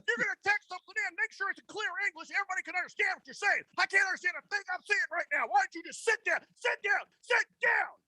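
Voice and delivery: high, fast, ranting scold at a texter; mock Dilfer coaching rant. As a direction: play an out-of-control coach chewing out a player. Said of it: "There, I gave him the Dilfer".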